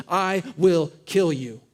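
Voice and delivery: slow, deliberate mock threat; ratcheted-up threat. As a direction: spell out the threat word by word. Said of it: "he ratchets it up a little bit more".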